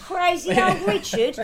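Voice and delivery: playful Australian storyteller voice; mock Australian. Mocking Richard's alibi in a light Australian accent. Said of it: "We're doing these accents".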